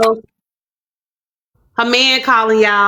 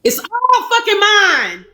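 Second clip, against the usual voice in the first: emphatic; 10 semitones higher, slower than usual. Real, not acted. Claiming everything emphatically.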